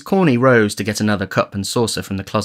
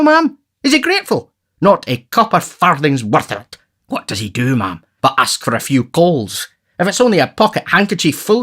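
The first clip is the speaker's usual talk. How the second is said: pompous beadle rising into outrage, higher on the questions; Mr Bumble indignant; 5 semitones higher, 4 dB louder than usual. Acted. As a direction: bluster at the ungrateful poor. Bumble complains to the matron.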